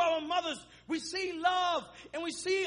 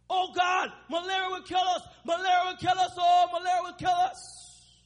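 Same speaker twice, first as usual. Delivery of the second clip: put-on thick African accent, crying out; 3 semitones higher, 5 dB louder than usual. Mother cries to God over sick child, thick African accent. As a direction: cry out as a desperate African mother. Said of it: "In a thick African accent, she cried as she held me".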